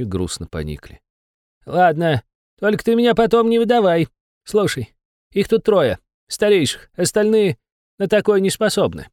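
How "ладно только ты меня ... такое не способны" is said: high, anxious little demon; a cowed imp giving in; nervous demon voice. Acted.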